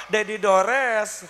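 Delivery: very slow, savoring the joke; husband answering the wife with a pun; drawn-out pun. Acted. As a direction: stretch the punchline out.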